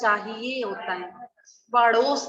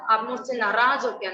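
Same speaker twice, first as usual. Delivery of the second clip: ordinary delivery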